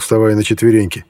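ordinary delivery

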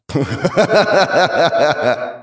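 loud, booming monster laughter; monstrous laugh. Acted. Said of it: "Смех разнёсся по всему полю боя".

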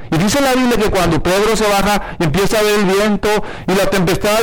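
usual speaking voice

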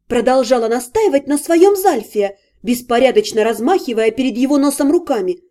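ordinary delivery, nothing marked

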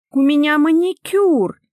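high, loud, precious protest; protesting wife. Acted. Wife refuses housework for her nails, high loud protest. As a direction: object in a high, indignant cry.

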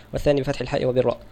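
ordinary talk

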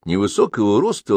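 plain reading voice

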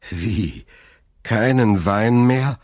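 appalled. A narrator's reading. Son learns the wine is gone, appalled. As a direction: drag it out in dismay. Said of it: "fragte Dantès entsetzt".

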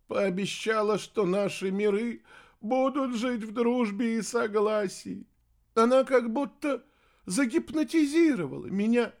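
high, tearful excuse-making; sobbing. A narrator's reading. Heir explaining his betrayal to mother, sobbing. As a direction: whimper the excuses, pitched high. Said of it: "всхлипнул носатый".

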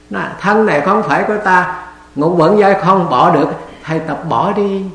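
monk urging the listeners to let go of everything, preaching hard; fervent preaching. Acted. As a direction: drive each line home to the listeners. Full-force preaching on letting go.

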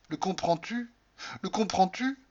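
high, rising insistent repeat; anguished plea. Acted. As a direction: press him to understand. Supplique montante de Cordelia. (supplication aiguë et insistante)

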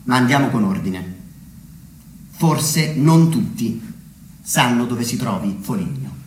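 ordinary talk

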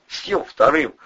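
high, pressured confession; loud, high-pitched blurting; cracking under questioning. A narrator's reading. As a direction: spill it all under threat. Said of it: "кололся Любчик".

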